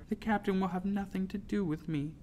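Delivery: high, small voice; small, humbled plea; begging. A narrator's reading. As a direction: plead meekly. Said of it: "I begged, my voice as small".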